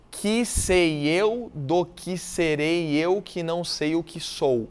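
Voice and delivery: very slow, mouth wide on each vowel; open vowels. Shown as a demonstration. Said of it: "é lógico que eu tô forçando". (bem devagar, boca aberta nas vogais)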